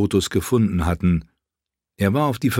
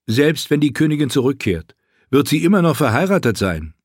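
higher, skeptical friend; lightly voiced friend poking holes; Garrus voice; 7 semitones higher than usual. Garrus objects. (höher, skeptischer Freund)

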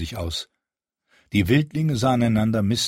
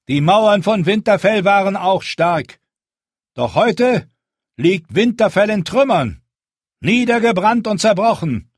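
high, loud and firm, a leader addressing a crowd; Jon's commanding speech; 11 semitones higher, 7 dB louder than usual. Acted. Jon rallies the hungry wildlings, firm and loud. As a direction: speak up to be heard by the whole camp.

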